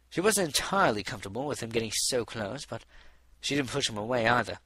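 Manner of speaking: usual speaking voice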